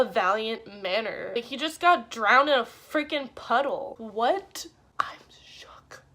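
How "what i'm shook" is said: stunned whisper. Real, not acted. Stunned by the ending.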